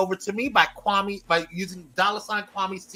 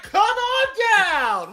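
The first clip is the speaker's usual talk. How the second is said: "Come on down" drawn out slow and high like a game show host, laughing; 10 semitones higher, 6 dB louder, much slower than usual. Retold or imitated. Host greeting viewers, playful game-show host voice. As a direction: hams up the Price is Right host call to the crowd. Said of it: "I feel like I'm on The Price is Right".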